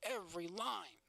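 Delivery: "every line" on the same falling beat; same measured flow. Shown as a demonstration. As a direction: echo a repetitive cadence on "every line". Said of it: "It's the same flow".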